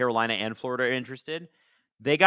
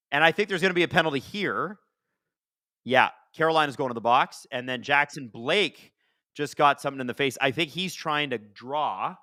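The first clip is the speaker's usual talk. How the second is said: usual speaking voice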